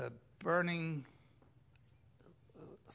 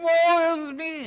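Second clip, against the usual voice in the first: high, loud cry of despair; mock wail; 13 semitones higher, 15 dB louder than usual. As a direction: wail it like a doomed sinner. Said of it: "without yelling, without crying".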